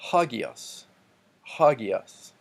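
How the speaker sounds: "hagios" with HA punched and an H at the start; first-syllable stress. Shown as a demonstration. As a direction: hit the HA of "hagios". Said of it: "the first syllable gets the emphasis".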